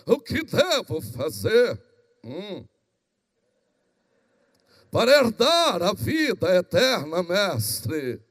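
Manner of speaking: slow, stretched pompous intoning; religious intoned voice. Acted. Law expert testing Jesus with a question, slow pompous churchy voice. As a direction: draw out the words with mock piety. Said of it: "voz de religioso, quando entonado, muda".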